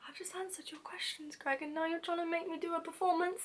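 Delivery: indignant whisper; whispered complaint to Greg; whispered protest. Retold or imitated. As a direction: grumble it under your breath. Inner protest at role play.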